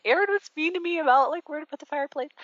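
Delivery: high, tearful quoted whine; high crying voice as she tells her dad; crying retold. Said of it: "I'm crying. I'm like".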